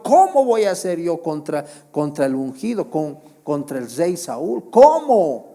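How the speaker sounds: David's protest climbing to a high, loud cry; anguished David. Acted. David refusing to harm King Saul, anguished cry. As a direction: plead how could I, then break into a cry.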